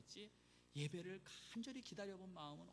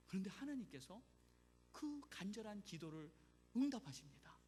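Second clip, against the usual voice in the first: usual speaking voice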